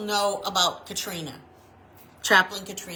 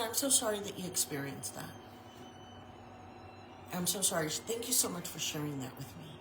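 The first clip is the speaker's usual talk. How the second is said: soft consoling; 4 semitones lower, 12 dB quieter, faster than usual. Retold or imitated. Consoling a hurt young woman.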